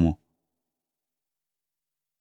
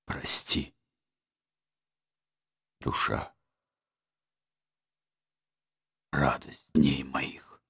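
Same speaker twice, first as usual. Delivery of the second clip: tender consolation; 4 dB quieter than usual. A narrator's reading. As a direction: comfort her gently. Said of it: "сказал он".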